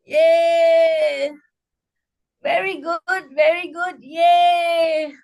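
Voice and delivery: drawn-out yay and chanted praise; upbeat group-cheer chant. Shown as a demonstration. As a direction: celebrate with a long, ringing yay. Cheer after celebration laughter.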